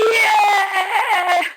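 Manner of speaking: big, high, loud cheer from the crowd; cheering yell. Pretend. Friend cheering him on at his debut, loud high yell. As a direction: whoop like a proud friend in the front row. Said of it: "I'm just gonna be there going".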